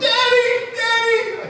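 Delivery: put-on very high-pitched child's call; imitated little daughter. Four-year-old waking dad at night, imitated high child voice. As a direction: mimic a small girl calling out for her father. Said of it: "my daughter comes into my room and goes".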